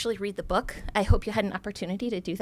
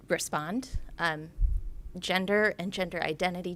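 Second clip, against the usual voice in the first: ordinary delivery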